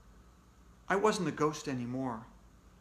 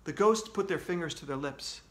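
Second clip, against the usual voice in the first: ordinary delivery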